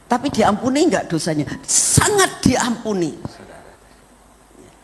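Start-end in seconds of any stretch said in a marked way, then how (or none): none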